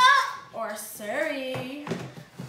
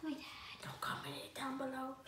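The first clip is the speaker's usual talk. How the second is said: whispered secret to the viewers; whispering; 3 semitones lower, 19 dB quieter than usual. Real, not acted.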